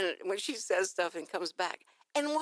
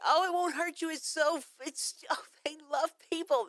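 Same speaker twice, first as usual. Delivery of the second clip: high, bright-eyed reassurance from a dog owner; higher, louder gushing dog owner; mock dog owner; 8 semitones higher, 3 dB louder than usual. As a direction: chirp it like a doting dog owner. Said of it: "they always say".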